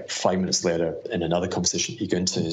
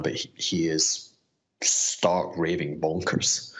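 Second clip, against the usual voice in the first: normal talking voice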